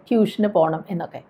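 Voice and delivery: usual speaking voice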